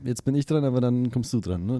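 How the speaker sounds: ordinary talk, nothing marked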